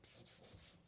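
whispered psst psst; cat-calling psst. Real, not acted. Calling the cat over with soft whispered psst sounds. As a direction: hiss softly to lure the cat.

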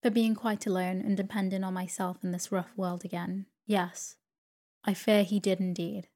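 usual speaking voice

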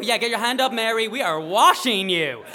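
loud, high, playful hype; rally them like a party crew; acted exuberant call-out; hyped-up cheering. Acted hype at the car wash.

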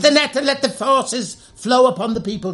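high, slow sermon-like proclamation; preacher voice. Pretend. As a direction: preach it grandly to a crowd. Preaching as Jesus would say.

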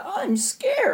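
very high, put-on little whimper; mock scared. He watches The Little Mermaid, high mock-scared voice. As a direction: squeak it like a frightened child.

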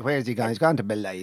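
low, broad Navan accent. Shown as a demonstration. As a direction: say believer with a broad ay vowel, Navan style. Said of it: "You have to say".

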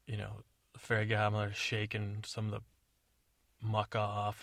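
ordinary talk

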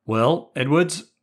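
a stern schoolmaster opening the interrogation; stern headmaster. Acted. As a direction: draw out his name like a stern headmaster. Headmaster breaks silence sternly.

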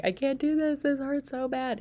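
pleading with her husband in labor, near panic; desperate plea. Retold or imitated. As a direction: plead with him, at the end of her rope. Telling husband she can't cope.

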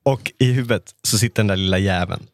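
ordinary talk, nothing marked

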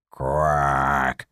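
a low, drawn-out croak of a quack; low toad quack. Acted. Toad answers the woman, a low drawn-out quack.

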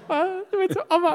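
put-on very high, childish whine; high squeaky voice. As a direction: squeak it out like a small boy. Boy's high broken reply.

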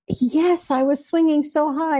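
lightly voiced little girl. Psychic voicing the ghost girl she met, lightly childlike.

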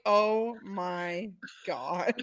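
mock shocked reaction. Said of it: "I'm like".

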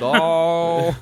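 dopey drawn-out cartoon groan; Homer Simpson. Podcaster parodying a cartoon dad, long dopey groan. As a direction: let the exclamation stretch out. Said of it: "kinda like Homer Simpson".

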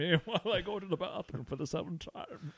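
lightly voiced old man, laughing; creaky old geezer asking to be excused. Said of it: "how did that turn into an old man voice".

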